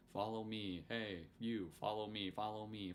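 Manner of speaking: very soft, repeated mock calling; Chuck. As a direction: badger a follower in a tiny, hushed voice. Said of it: "Chuck go".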